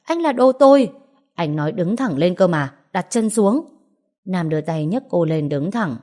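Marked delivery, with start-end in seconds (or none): 0.0-0.9 much higher, louder furious shout. Acted. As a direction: yell it at him, high and loud. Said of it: "quát lại".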